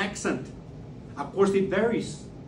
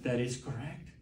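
low, soft acted concession; retold meek admission; 9 semitones lower, 13 dB quieter than usual. Man conceding to the girlfriend who hammered him, meekly. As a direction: quietly give in, as retold. His chastened answer after being hammered.

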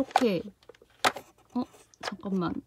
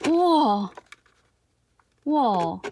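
amazed wow; 3 dB louder, much slower than usual. Real, not acted. Host marvels as the toy container opens, amazed.

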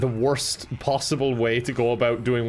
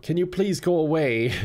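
ordinary talk

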